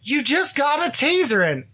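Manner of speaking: high, incredulous retold exclamation; astonished mock reaction. Husband to wife after the door check, astonished.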